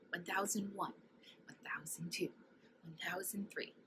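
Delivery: soft whispered count under the breath. Shown as a demonstration. Narrator counting softly while the states take their places. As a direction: count the seconds in a hush. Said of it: "give them a couple of seconds".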